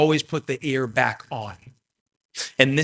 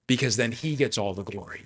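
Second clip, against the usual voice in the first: ordinary talk, nothing marked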